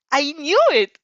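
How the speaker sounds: loud, high, triumphant cry; giddy. A narrator's reading. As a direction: crow it excitedly. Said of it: "your shadow giddy voice".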